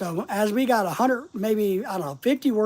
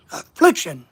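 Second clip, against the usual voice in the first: "Affliction" with a loud punch on FLIC; stressed syllable; 3 semitones lower, 6 dB louder than usual. Shown as a demonstration. Speaker showing how to emphasize part of a word, hard stress inside "Affliction". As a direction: hit the middle of "Affliction" hard. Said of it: "emphasizing part of the word".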